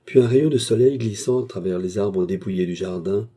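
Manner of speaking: usual speaking voice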